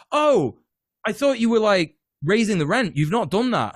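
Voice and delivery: high-pitched mock-innocent surprise; feigned surprise. Tenant to landlord asking for money, feigned surprise. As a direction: act innocently taken aback, voice lifted.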